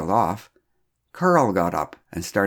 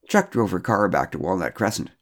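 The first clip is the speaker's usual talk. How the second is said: plain reading voice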